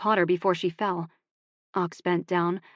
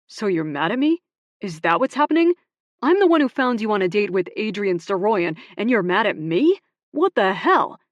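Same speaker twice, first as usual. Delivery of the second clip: louder, indignant shouting; angry disbelief; 3 semitones higher, 6 dB louder than usual. Acted. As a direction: shout back, outraged. Ox's angry street confrontation.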